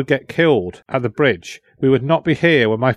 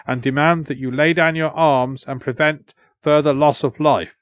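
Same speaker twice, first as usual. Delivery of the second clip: higher, formal proclamation for a king; translator delivering a demand; 4 semitones higher than usual. Acted. Translator addressing the fort for his king, formal and commanding. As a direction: declare the terms to the defenders.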